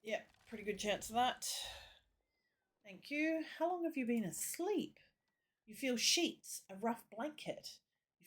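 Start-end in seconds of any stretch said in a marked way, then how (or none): 2.8-3.4 higher priest thank you. Acted. 3.6-5.0 higher, gentle priest voice; a lilting priest greeting the wounded soldier. Acted.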